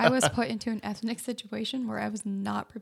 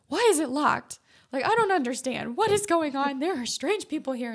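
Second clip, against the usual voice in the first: loud, higher-pitched bewildered complaint; acted exasperation; 5 semitones higher, 7 dB louder than usual. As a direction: replay a worked-up rant at your boyfriend. Acted quarrel about the gas station.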